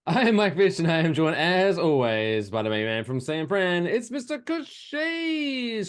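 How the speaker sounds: big announcer build, stretching the name; hyped co-host introduction. Acted. Host introducing his co-host to listeners, showy announcer.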